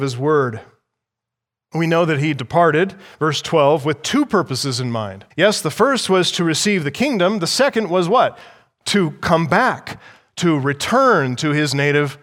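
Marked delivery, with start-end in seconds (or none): none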